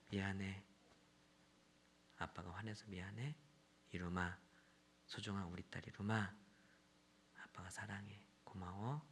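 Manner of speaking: very soft, low, with gentle pauses; dad soothing his daughter almost in a whisper; tender apology. Retold or imitated. (딸에게 낮고 부드럽게 사과하는 말투)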